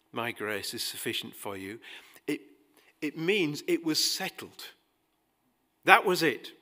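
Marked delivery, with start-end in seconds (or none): 0.1-1.8 lower, softer reread of the verse; quiet emphasis. Shown as a demonstration. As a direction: drop down and savour the words. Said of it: "The tense of the verb".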